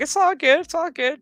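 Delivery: reassuring dad. Retold or imitated. Father reassuring daughter, quoted.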